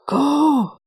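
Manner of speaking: high, tight cry through gritted teeth; gritted-teeth shout. Acted. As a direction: force it out through clenched teeth. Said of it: "with gritted teeth".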